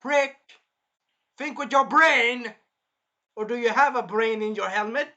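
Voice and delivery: loud, high ranting retort; mock-angry yell at a fool; exasperated. Yells retort at the character.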